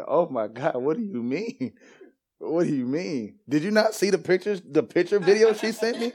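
incredulous disbelief. Retold or imitated. Retelling his shock at Gene's word.

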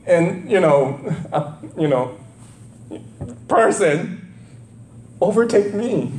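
high, aggrieved pleading complaint; hammed-up indignant protest; mock whining Moses. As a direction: whine at God like a passed-over employee. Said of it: "Moses goes".

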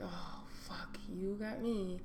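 soft unvoiced whisper. Real, not acted. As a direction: whisper the surprise. Caught off guard.

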